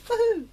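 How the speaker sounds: high, loud "woohoo"; triumphant whoop. Real, not acted. Pleased to have remembered the song, high whoop. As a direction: whoop high at a small win.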